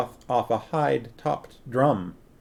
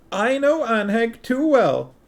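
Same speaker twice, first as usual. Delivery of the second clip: higher, sniffy queen; sniffing queen; 11 semitones higher than usual. A narrator's reading. Queen dismisses her husband's promise, higher and sniffy. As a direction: sniff it off like a knowing wife. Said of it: "the queen sniffed".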